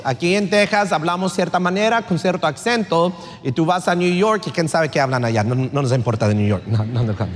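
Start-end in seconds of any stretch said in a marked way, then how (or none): 5.4-7.3 mock dismissal. Dismisses New York, aside.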